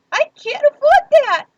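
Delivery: very high and loud fake panic; mock distraught wail. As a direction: wail it like you're falling apart. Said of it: "all the emotional trauma in my voice".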